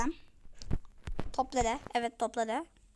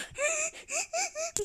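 squealing high laugh; 7 dB quieter than usual. Real, not acted. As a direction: burst out in a high giggle. Laughs after silly rhymes. (yüksek perdeden kıkırdama)

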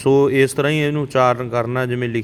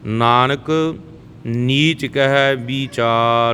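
Nanak, a short pause, then ਨੀਚ ਕਹੈ ਵੀਚਾਰ; pause after Nanak; much slower than usual. Shown as a demonstration. Teacher shows learners the reading, pause after Nanak. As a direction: rest briefly on Nanak before going on. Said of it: "Nanak ਤੇ ਜਮਕੀ ਦੇਣੀ ਹੈ".

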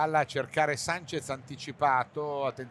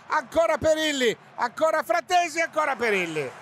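high, loud, rapid-fire names; excited shout; 10 semitones higher, 4 dB louder than usual. Real, not acted. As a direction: shout each save in turn. Repeated saves, commentator shouts. (telecronaca urlata)

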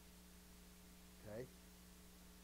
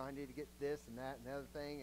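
quick, softer mental shopping list; inner list; 3 semitones lower, 5 dB quieter, faster than usual. Retold or imitated. Believer listing groceries in his head, fast soft mutter. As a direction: rattle it off under your breath. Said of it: "You know".

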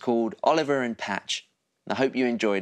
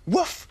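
high, rising woof; small dog's yap, pitched high; dog bark. Acted. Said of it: "barked Patch".

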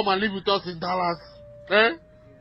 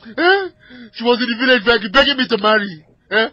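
loud, crying rage; tearful shouting; 9 dB louder than usual. Acted. Husband ranting about his wife to the dispatcher, crying and shouting. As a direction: yell it while choking up. Gunman shouting through tears.

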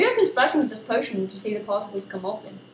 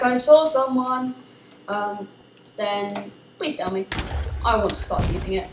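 ordinary delivery

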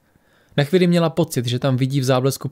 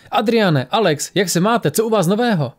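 high-pitched, jovial exclamation; amused greeting; 7 semitones higher than usual. A narrator's reading. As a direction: greet an unexpected gathering with delight. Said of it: "zasmál se Ryan".

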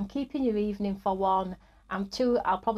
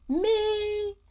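excited; 10 semitones higher than usual. Acted. Answering her own excited question.

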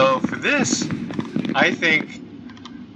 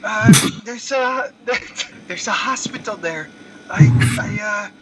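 put-on terrible Filipino accent; 7 semitones higher, 3 dB quieter than usual. Gamer voices a Pacquiao character asking LeBron for help, mock Filipino accent. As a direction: play a bad Pacquiao-style accent. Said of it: "It's a terrible accent if you know that accent".

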